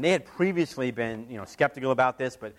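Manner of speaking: ordinary delivery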